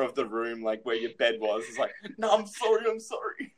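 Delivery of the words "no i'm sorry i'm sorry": put-on high-pitched kid begging forgiveness; mock pleading apology. Kid cornered by the bed, mock pleading.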